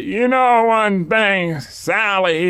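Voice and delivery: slow, high Southern drawl imitation; a hint of Southern accent talking to his wife. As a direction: mimic a Southern tourist lightly. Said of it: "strong Southern accent".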